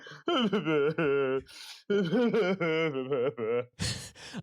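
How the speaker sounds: long comic mock sobs; imitated sobbing. Screening guest as the lights come up, mock sobbing. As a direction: blubber exaggeratedly for several seconds. Acts out sobbing after film.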